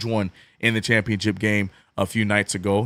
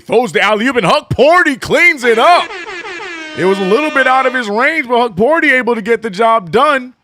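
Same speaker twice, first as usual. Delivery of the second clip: high, loud hype call of the alley-oop; live play-by-play; 14 semitones higher, 9 dB louder than usual. Acted. Streamer calling the Knicks game to chat, hyped and loud. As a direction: call the dunk like a TV announcer going wild.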